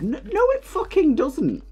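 high-pitched, indignant denial; exasperated rebuttal. Real, not acted. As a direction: shoot the suggestion down, voice leaping up. Exasperated rebuttal of retold suggestion.

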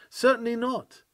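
ordinary talk, nothing marked

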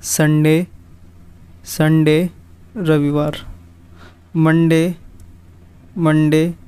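classroom drill with a pause after each word; repeat after me. Shown as a demonstration. Teacher drilling students through the days. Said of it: "एक बार repeat कर लेते हैं".

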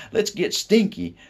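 high, loud mock tough guy; tough-guy boast. Boy imagines shocking grandmother, high tough-guy voice. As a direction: play the bad boy to shock her. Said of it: "he'd say".

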